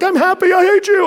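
high-pitched, very loud mock outburst; unhinged screaming. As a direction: act out a man with no emotional brakes, screaming. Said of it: "screaming at a guy, then crying".

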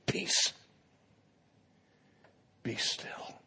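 Peace, be still whispered, very soft; hushed command. Pretend. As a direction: breathe the command gently like calming a child. Jesus calms storm, quiet retelling.

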